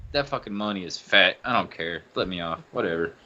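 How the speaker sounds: low, soft shrug-off; dismissive. Retold or imitated. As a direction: drop the voice, mildly unbothered. Retells shrugging off the insult.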